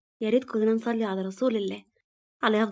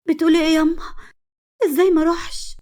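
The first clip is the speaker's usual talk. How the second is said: high, raised pleading with mother; distressed protest; 8 semitones higher, 4 dB louder than usual. Acted. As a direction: protest desperately, pitch held high. Daughter protests, desperate about Adnan.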